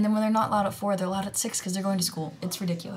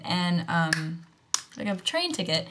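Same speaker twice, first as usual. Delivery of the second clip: ordinary delivery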